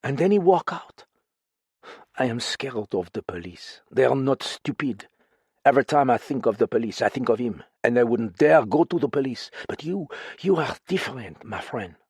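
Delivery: frightened foreign woman confiding in a friend; lightly French-tinged voice, uneasy and pleading; accented woman character. Acted.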